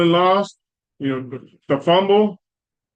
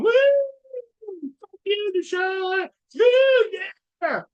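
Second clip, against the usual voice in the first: much higher mock-drunk fan whoops and jeers; rowdy fan yells; slower than usual. Host imitating drunk fans jeering the injured quarterback, hooting high. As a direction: whoop it up like a rowdy heckler in the stands. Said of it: "ten people going".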